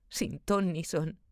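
usual speaking voice

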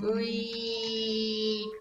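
long, high squeal of a jump; playful whee. Pretend. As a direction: draw it out high as if falling.

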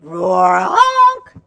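silly goose trying to roar; roar honk. Shown as a demonstration. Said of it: "Everybody do it again".

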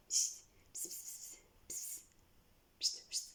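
soft unvoiced psst-psst to lure the cat; whispered cat call. Real, not acted. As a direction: whisper to coax the cat closer.

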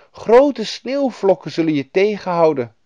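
high, loud warning; worried Finnish woman. A narrator's reading. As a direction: warn a child with concern. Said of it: "die schudde bezorgd het hoofd".

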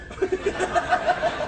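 squeaky burst of laughter; high giggle. Real, not acted. Man laughs at his own pun, high giggle.

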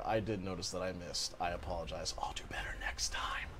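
softly muttered apology, fading to a whisper; hushed mock apology. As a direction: murmur it like a sheepish apology.

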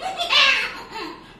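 loud, high startled cat screech; cat yowl. Acted. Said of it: "the cat says".

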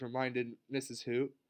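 ordinary delivery

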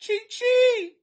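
high, loud squeal of the name; playful high holler; gleeful name call. Pretend. Calls out fan's funny name.

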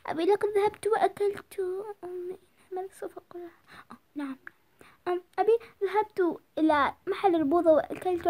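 put-on a girl fumbling for a cover story; sheepish daughter. Daughter lying to her father, quietly nervous.

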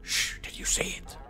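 hushed, slow secretive question; whispered line. Acted. Journalist reads the hunter's line, hushed and secretive.

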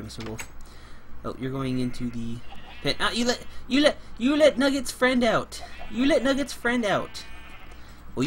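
usual speaking voice

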